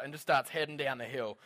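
normal talking voice

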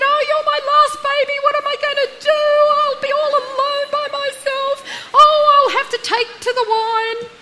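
put-on a mother crying as her last child leaves home; tearful mother. Mother wails at her child moving out, in a high, loud tearful voice. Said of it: "the mother bursts into tears and said".

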